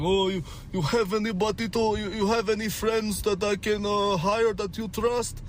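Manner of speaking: high-pitched mock Russian accent; Russian boss. Russian boss asking me for friends to hire, high mock Russian accent. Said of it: "'cause my boss was Russian".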